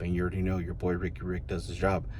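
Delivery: normal talking voice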